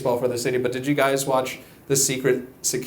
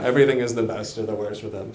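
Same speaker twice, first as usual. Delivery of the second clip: usual speaking voice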